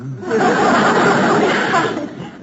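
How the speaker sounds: a short, hearty laugh at the joke; warm laugh. Acted. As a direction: laugh warmly along with him. Wife laughs at husband's joke.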